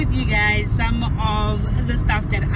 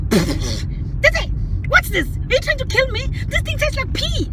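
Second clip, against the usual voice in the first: much higher mock outrage; mock outraged mother; 14 semitones higher than usual. Mum reacting to daughter's tea, mock outraged. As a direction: imitate mum blowing up at the tea. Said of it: "And she says".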